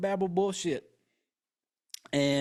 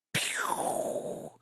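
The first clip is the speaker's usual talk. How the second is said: soft gunshot pew; 4 dB quieter than usual. Retold or imitated. Finger gun firing.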